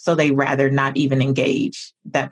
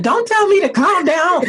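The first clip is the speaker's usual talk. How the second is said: much higher, louder playful protest; high-pitched mock outrage; mock indignant outburst; 13 semitones higher, 4 dB louder than usual. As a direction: act out an exasperated comeback, pitched way up. Acting out her usual snapped reply.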